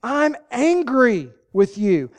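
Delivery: acted angry confession. Preacher acting out confessing anger to the offender, emphatic and slow. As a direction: let each word out slowly and emphatically.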